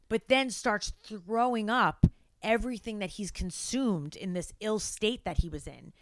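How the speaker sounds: usual speaking voice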